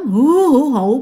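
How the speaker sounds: drawn-out mock sobbing "hu hu"; imitated ghostly wail. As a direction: imitate a ghost's mournful crying. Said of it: "Tiếng khóc thảm thiết dữ lắm". (giả tiếng ma khóc thảm thiết)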